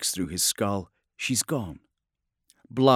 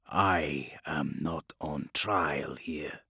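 slow, soft and lower; low quiet retort; 5 semitones lower, 6 dB quieter, much slower than usual. Acted. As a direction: answer coldly under breath.

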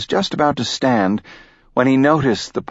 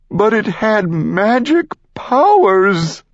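high, slow pleading unicorn; 11 semitones higher, slower than usual. Acted.

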